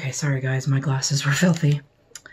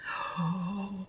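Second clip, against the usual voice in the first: a soft, awed gasp; hushed gasp; 2 semitones higher, 12 dB quieter than usual. Real, not acted. Viewer gasping at the lipstick packaging, soft awed gasp. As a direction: catch your breath at the packaging.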